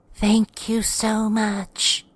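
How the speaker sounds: put-on very high, slow old woman; old lady. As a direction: thank someone slowly in a high old-lady voice. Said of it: "The old lady wandered off".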